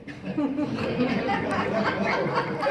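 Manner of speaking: breathy chuckle at the joke; real laugh. Real, not acted. Speaker laughs at his own bad-day example, chuckling. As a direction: let a laugh roll out.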